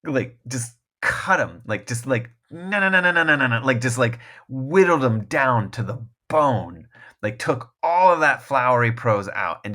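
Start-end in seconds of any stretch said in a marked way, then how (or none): 2.5-3.6 rapid high-pitched "nanana" chopping sound; quick imitation of frantic snipping; mimicked cutting noise. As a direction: rattle off a high stream of little cuts.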